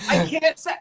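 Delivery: giggling, pitch jumps high; laughing. Real, not acted. Seller laughs at her own accent, giggling high. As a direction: laugh through the words. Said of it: "I know".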